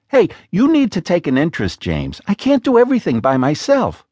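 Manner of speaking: a hint of an exasperated wife pressing her husband; Lena's voice. A narrator's reading.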